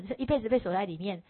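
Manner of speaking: usual speaking voice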